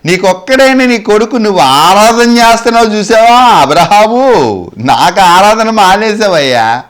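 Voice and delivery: high, drawn-out mock complaint; sarcastic reproach. God chiding Abraham for neglected worship, mock-reproachful. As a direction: call him out, teasing and aggrieved. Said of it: "భక్తుడిని ఎటకారం చేస్తున్నాడు".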